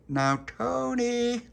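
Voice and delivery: very high, drawn-out call; stretched high holler; shout through letterbox. Acted. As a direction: call a friend out, sing-song and slow. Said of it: "he shouted through the letterbox".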